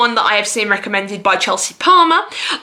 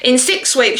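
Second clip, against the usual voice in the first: ordinary talk, nothing marked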